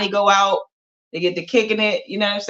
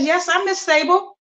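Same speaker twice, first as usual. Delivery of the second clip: mom answering; 9 semitones higher than usual. Acted. Mom answers delivery man.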